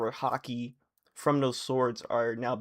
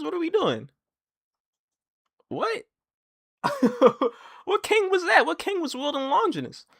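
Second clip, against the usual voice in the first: much higher and louder, laughing in shock; disbelief; 4 dB louder than usual. Real, not acted. Fan shocked at the Longinus reveal, high disbelief. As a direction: react in stunned disbelief.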